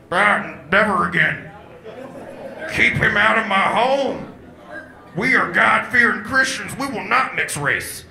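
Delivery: a bigoted father laying down the law; angry dad. Acted. Dad forbids the boyfriend in his home, loud and indignant.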